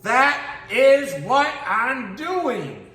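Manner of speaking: high, very slow exasperated voice; exasperated tortoise. Acted. Little tortoise answers the cheetah, slow high exasperation. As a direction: drag each word out, fed up.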